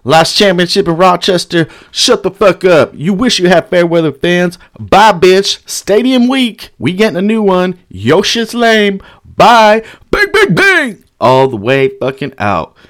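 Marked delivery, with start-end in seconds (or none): 0.0-11.0 high, chanted jeering; trash talk. Retold or imitated. Kicked-out fan heckling Kings crowd, high jeering trash talk. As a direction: heckle in raised, drawn-out taunts. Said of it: "I was talking shit".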